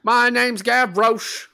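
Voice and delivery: put-on high, loud, slow Cockney boy; Cockney accent. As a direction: belt it like a Cockney urchin. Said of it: "thick Cockney accent boy".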